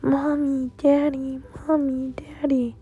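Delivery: put-on high, plaintive little boy calling his parents; mild mock scream in a small, high voice; scared child calling. A narrator's reading. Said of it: "got really scared and screamed".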